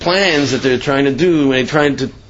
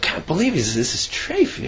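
shocked disbelief; 4 semitones higher, 7 dB quieter than usual. Retold or imitated. Shocked man sees friend eating treif.

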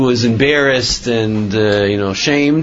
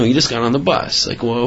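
ordinary delivery

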